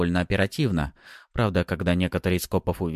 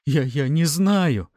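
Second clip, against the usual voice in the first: high, slow, stammering fear; frightened, faltering denial; trembling voice; 8 semitones higher, 5 dB louder, slower than usual. A narrator's reading. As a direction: stammer it out, scared and raised in pitch. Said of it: "дрожащим голосом пробормотал тот".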